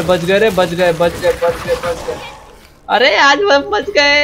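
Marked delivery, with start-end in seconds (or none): 2.9-4.2 high, loud, drawn-out exclamation; excited yell. Real, not acted. Gamer reacts to a narrow escape, excited yell. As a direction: yell it high and long after a near miss.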